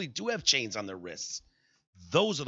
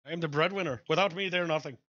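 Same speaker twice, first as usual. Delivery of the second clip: higher, odd put-on killer voice; mock killer's boast; 5 semitones higher than usual. Host voicing the killer justifying the murders, put-on boast.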